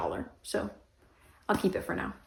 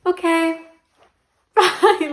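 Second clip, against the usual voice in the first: high, flustered goodbye with a laugh; pitched up, laughing at her own awkwardness; awkward quick goodbye; 10 semitones higher, 7 dB louder, much slower than usual. Retold or imitated. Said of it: "I was like".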